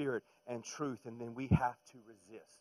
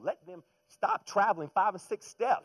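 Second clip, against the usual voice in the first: exasperated; 8 semitones higher, 11 dB louder than usual. Real, not acted. Griping about NBA.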